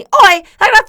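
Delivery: loud, high Cockney babble; Cockney impression. Host mimicking her date's phone voice, loud Cockney. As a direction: shout garbled mock Cockney. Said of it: "he's got the Cockney".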